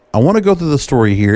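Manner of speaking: ordinary talk, nothing marked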